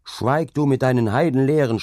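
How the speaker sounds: loud, high angry shout. A narrator's reading. Hauke is shouted at by the laborer, a loud angry yell. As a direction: shout him down. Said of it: "schrien Hauke an".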